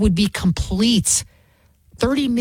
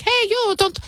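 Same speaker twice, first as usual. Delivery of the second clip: high-pitched mock Irish woman; tentative Irish impression, pitched up; attempted Irish accent; 12 semitones higher than usual. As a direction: call out in a put-on Irish accent. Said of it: "I'm trying to do my Irish".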